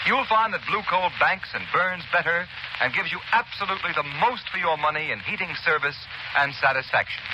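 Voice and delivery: usual speaking voice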